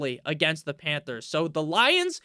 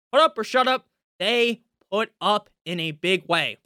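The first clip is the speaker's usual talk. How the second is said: normal talking voice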